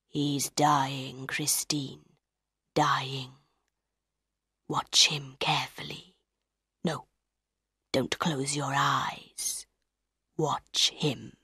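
low, soft, slow menace; menacing captor. A narrator's reading. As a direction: quietly force her to watch, voice dropped low. Captor makes her watch lover die.

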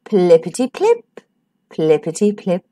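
low, fading plippity-plip; gently fading sound effect. A narrator's reading. Story ending echo of sound.

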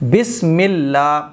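اللہ with a thin lam, said slowly; careful tajweed on بسم اللہ; correct recitation. Shown as a demonstration. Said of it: "لام جو ہے وہ باریک".

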